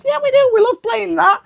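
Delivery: very high squeaky voice, laughing; imitating Worms. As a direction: chirp it out in a giddy high squeak. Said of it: "That's how I think they talk to each other".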